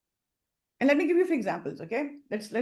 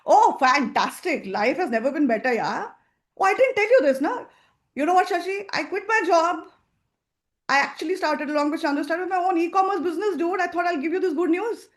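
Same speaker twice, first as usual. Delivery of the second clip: louder and higher, upbeat; confident, excited news; 5 semitones higher, 5 dB louder than usual. Shown as a demonstration. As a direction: share the big news proudly. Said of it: "You see the tone, the energy?".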